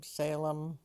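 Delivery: ordinary talk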